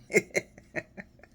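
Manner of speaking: high unvoiced giggle; breathy giggle. Real, not acted. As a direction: burst into a breathy laugh. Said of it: "I got a little tickled".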